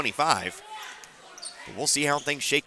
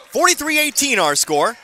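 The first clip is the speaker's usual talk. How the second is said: excited score call; 11 semitones higher, 7 dB louder than usual. Real, not acted. Calling out the score.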